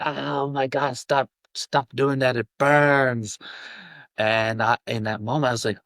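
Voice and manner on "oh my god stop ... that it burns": higher, pained, pleading voice for depression; depression as a burning vampire. Retold or imitated. Depression recoiling from self-love, pleading in a higher pained voice. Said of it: "My depression was like".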